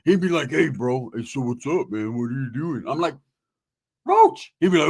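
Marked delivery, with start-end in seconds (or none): 0.5-2.8 lightly voiced, lower casual greeting; a hint of another man's unguarded voice; Roach's real voice. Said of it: "his real voice would come out". 4.1-4.5 very high, loud squeal of the name; startled call. Retold or imitated. Startled reaction to the changed voice.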